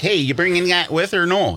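high, bright Wisconsin "with or no"; Wisconsin accent. Retold or imitated. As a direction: toss it off like a Wisconsin local. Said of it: "I'm like".

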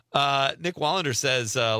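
usual speaking voice

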